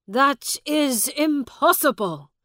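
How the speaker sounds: high, loud outburst; shouted disbelief. A narrator's reading. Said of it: "shouted Miles".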